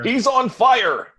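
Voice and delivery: big arcade announcer call, high and slow. Retold or imitated. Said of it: "I like the announcer voice for that".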